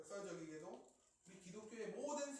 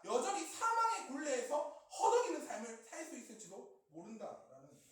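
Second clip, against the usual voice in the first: loud, high, emphatic proclamation; forceful preaching; 5 semitones higher, 10 dB louder than usual. Real, not acted. (힘주어 외치는 설교조)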